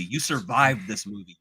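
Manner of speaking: usual speaking voice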